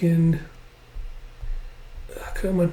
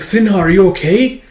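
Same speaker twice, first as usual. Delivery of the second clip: high, raised, anxious question; worried calling; 7 semitones higher, 5 dB louder than usual. Acted. Yun checks on arrow-struck friend, worried. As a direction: call out with urgent concern.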